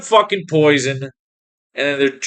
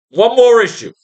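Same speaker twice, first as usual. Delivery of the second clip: higher and louder, stressing more; angry outburst; 8 semitones higher, 6 dB louder than usual. Real, not acted. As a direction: bark it out, fed up with the comics. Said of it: "I'm getting more pissed".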